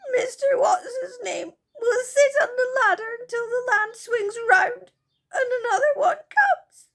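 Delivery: high, loud sobbing little fairy. Acted. Silky sobs to the children about Moonface, high and tearful. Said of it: "said Silky".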